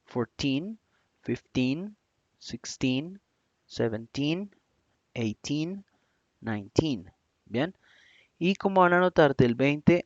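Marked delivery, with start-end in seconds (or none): 0.1-7.1 put-on each number with "teen" stressed. Shown as a demonstration. Teacher drilling teen stress for students. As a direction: lean on "teen" every time. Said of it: "ahí es donde va la acentuación".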